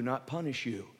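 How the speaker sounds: ordinary delivery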